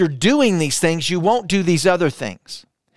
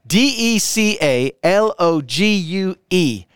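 slow, each letter of D E C A L O G U E said separately; spelled out letter by letter. Shown as a demonstration. Pastor spells the word for the online chat, slow and deliberate. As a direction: spell it out so they can type it. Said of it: "Hopefully I got that right".

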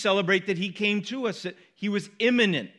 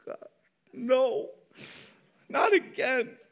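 imitated dreading kids; 5 semitones higher, slower than usual. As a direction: play the kids dreading Dad's sermon. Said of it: "And they're like".

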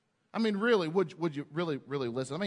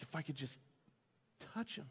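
much softer, near-breathless longing; hushed hope; 4 semitones lower, 15 dB quieter, faster than usual. Retold or imitated. Sick woman hoping to touch Jesus, hushed. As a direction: breathe it as a secret wish. Woman's hopeful thought, voiced softly.

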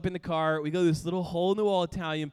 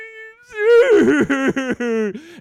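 mock sob; 9 semitones higher, 12 dB louder than usual. End of imitated sobbing.

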